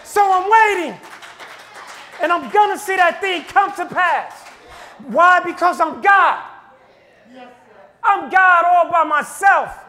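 rising, shouted preaching as God; preaching at full force. Acted. Preacher voicing God to the congregation, shouting. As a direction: preach it loud and building.

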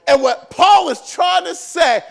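loud, fervent pulpit preaching; preaching at full force. Acted. Pastor rousing the church about authority, full-force preaching. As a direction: drive it home to the congregation.

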